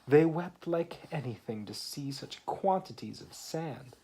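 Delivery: lively read-aloud poem voice; story reading. Acted. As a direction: read the rhyme to a small child. Reads poem aloud to child.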